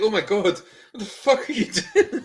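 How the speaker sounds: high-pitched, laughing exasperation; baffled fan protesting through laughter; exasperated disbelief. Retold or imitated. Fan reacting to a rambling promo, exasperated disbelief.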